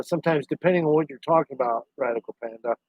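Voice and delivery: usual speaking voice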